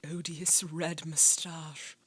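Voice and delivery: slow, breathless whisper. Real, not acted. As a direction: whisper it slowly and with disgust. Repeats the line from the clip.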